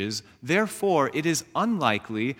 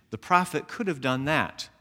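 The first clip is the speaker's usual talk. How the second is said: normal talking voice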